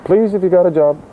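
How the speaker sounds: playful high-pitched begging; mock pleading. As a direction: plead for work, half joking. Jokingly begs listeners for work.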